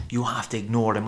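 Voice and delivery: ordinary talk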